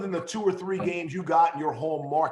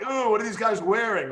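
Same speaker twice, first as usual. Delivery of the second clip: lightly mimicked kid, higher-pitched disbelief; mock a puzzled youngster, pitched up; baffled young kids; 6 semitones higher, 4 dB louder than usual. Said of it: "I'm sure the young kids are like".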